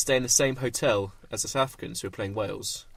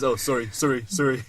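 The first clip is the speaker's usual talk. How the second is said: put-on South African accent. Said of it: "Cheeky South African accent there".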